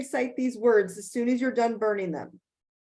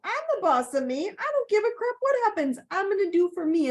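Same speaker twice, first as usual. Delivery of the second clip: defiant self-talk; 9 semitones higher than usual. Shown as a demonstration. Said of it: "And you can go".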